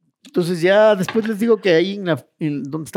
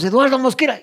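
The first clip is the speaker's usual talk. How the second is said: higher, mildly shouted name; retold shout; 7 semitones higher, 4 dB louder than usual. Prisoner answering a policeman who can't hear him, retold shout. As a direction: call your name out for someone who claims not to hear. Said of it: "Le gritas".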